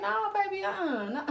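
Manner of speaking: high, playful no-no; teasing him away; coy refusal. Retold or imitated. Fending him off.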